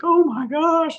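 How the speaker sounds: high-pitched mock tearful cry; imitated crying. Wrestler seeing his mother at the roast, mock tearful. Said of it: "He's, like, crying".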